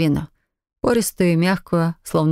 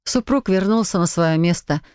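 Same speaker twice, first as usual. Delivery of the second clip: plain reading voice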